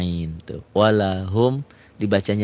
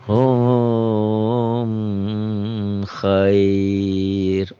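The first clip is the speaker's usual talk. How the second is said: Quran recitation. Shown as a demonstration. Recites example slowly.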